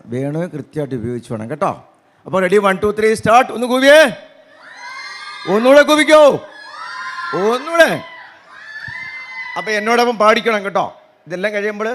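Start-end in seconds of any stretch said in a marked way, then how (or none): 3.5-7.9 higher and louder call to hoot; loud urging. Real, not acted. Performer urging the audience to hoot again, loud. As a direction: push the crowd louder.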